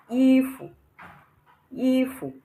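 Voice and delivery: "ifu" with high held i, short fu; neutral tone. Shown as a demonstration. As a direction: hold i high and level, drop to a quick fu. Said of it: "there is no tone on the U".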